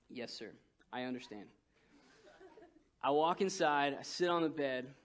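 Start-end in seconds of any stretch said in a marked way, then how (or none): 0.1-1.5 much softer, lower, chastened; meek son to stern dad; meek reply. Retold or imitated. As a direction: answer like a scolded boy. Son answering his stern father.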